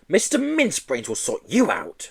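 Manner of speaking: triumphant. Acted. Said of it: "announced Miss Hatchett triumphantly".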